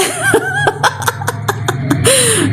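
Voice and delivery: a high, breathy burst of real laughter. Real, not acted. Streamer laughs at a viewer's comment, a high burst of laughter. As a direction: crack up at the comment.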